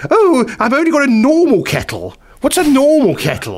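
high-pitched, haughty posh complaint; hammed-up posh lady voice; posh, arrogant woman. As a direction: moan like an affronted upper-class guest. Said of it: "really posh, arrogant woman".

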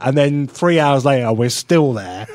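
ordinary talk, nothing marked

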